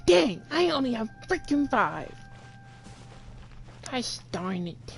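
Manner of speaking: normal talking voice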